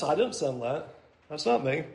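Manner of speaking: God disowning them. Retold or imitated. Preacher voicing God to the congregation, quiet and low. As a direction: drop it low and quiet, like a firm aside.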